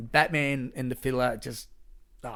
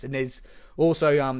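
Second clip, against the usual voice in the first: ordinary talk, nothing marked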